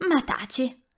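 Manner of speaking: exclaimed hush. A narrator's reading. Rossella exclaiming at Melania again, sharp. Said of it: "esclamò di nuovo Rossella".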